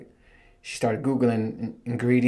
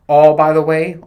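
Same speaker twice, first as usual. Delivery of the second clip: loud, louder; raised voice cutting in; loud emphatic aside; 4 semitones higher, 11 dB louder than usual. Real, not acted. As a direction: blurt it out loudly. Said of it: "repeated louder".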